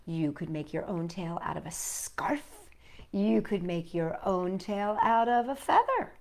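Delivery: ordinary delivery, nothing marked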